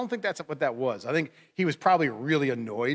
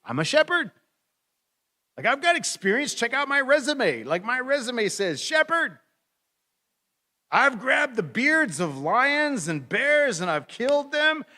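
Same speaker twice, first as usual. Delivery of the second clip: put-on eager young David; 9 semitones higher, 3 dB louder than usual. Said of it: "he says".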